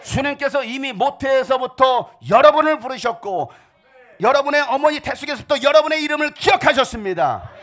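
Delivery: loud, rising, half-sung preaching; preaching at full force. Acted. Pastor calling out to the congregation, fervent sung preaching. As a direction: preach it to the rafters with music under you.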